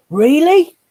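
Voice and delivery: high, loud amazed really; mock amazement. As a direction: gasp it out like it's astonishing. Mock amazed reaction to snake fact.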